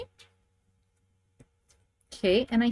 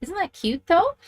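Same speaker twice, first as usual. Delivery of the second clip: delighted; 9 semitones higher than usual. Real, not acted. Admiring her finished card.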